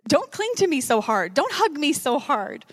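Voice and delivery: joyful, playful. Acted. Jesus laughing as Mary launches herself at him, playful. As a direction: laugh it off like a dad being hugged too hard. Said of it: "There's this sense of joy of like".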